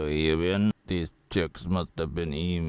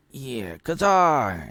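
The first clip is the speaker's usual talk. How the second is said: slow, loud put-on Russian accent, higher; hammed-up Russian toast after smashing a glass; drawn-out Russian accent, hammed up; mock Russian accent; 6 semitones higher, 6 dB louder, much slower than usual. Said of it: "like he's Russian".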